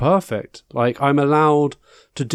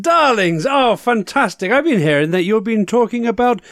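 campy drag queen; 5 semitones higher than usual. Acted. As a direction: gush every word like a glamorous diva. Host character, campy darling persona.

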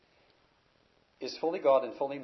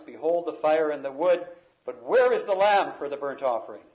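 loud preaching; 3 semitones higher, 9 dB louder than usual. Acted. Preacher reads the central question.

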